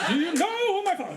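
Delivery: high, laughing rehearsal; rehearsed entitled line. Pretend. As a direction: try out the big line. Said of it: "I'm doing line readings".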